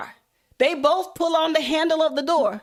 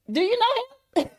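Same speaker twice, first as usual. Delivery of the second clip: suspicious question; 8 semitones higher than usual. Retold or imitated. Suspicious G Mama questions friend.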